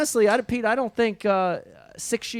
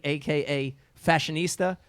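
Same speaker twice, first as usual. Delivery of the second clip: usual speaking voice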